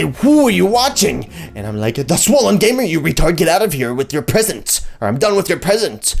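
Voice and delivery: loud, high irritated mock rant; aggressive. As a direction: bark it back, hammed-up. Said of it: "in a slightly aggressive manner".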